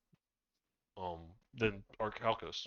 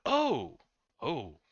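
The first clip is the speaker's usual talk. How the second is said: surprised gasp; 4 semitones higher, 8 dB louder than usual. Acted. GM voices NPC surprise.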